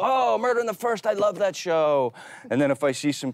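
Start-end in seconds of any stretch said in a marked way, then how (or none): 0.0-2.1 excited fan voice. Retold or imitated. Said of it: "agents at the airport all go".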